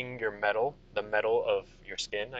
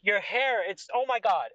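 high, breaking off in adoration; infatuated king; 10 semitones higher, 5 dB louder than usual. Acted. King feeling Ragna's hair, infatuated. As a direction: gush high, lost for words. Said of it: "He's fu-, he's freaking infatuated".

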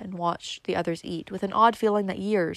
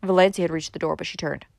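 ordinary delivery

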